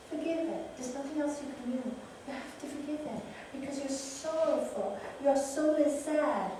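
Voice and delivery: ordinary delivery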